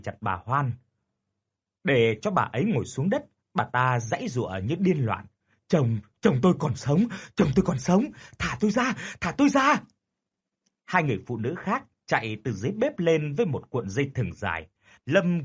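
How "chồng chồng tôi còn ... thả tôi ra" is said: frantic pleading. A narrator's reading. Widow struggling, tied up.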